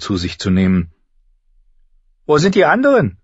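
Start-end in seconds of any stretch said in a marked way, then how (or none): 2.3-3.2 put-on high, loud girl's voice asking a question; curious younger sister. Younger sister asks at breakfast where everyone is, curious.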